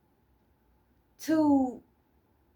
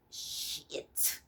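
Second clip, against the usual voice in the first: breathy whispered swear under the breath; whispered curse; 14 dB quieter than usual. Real, not acted. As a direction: drop the curse to a bare whisper. Curse dropped mid-sentence.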